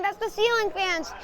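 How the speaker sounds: normal talking voice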